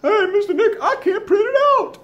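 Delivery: squeaky laughing student asking for help; mock student voice, high and giggly; student's note voiced. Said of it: "leave me a note".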